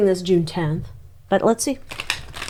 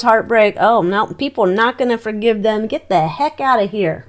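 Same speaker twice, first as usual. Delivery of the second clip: usual speaking voice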